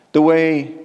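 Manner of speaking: usual speaking voice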